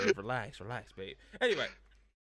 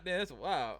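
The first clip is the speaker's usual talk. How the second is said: normal talking voice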